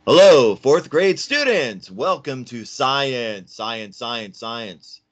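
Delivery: loud, high, drawn-out announcer-like call, fading at the end; loud demo. Shown as a demonstration. Teacher welcoming fourth graders to science, loud demo. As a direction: call out big and slow, then let it echo away. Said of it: "talk a little bit louder".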